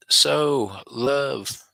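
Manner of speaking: mock her message with a lightly put-on voice; reading chat in a voice. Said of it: "she says".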